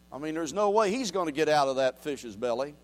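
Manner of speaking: ordinary talk, nothing marked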